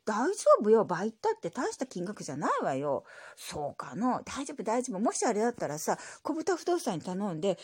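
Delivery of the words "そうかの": lightly voiced old grandpa; grumbly old grandpa reply; old man voice. Grandpa character voice.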